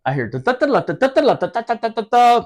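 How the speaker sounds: sung bugle call; trumpet imitation. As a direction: hum the reveille tune.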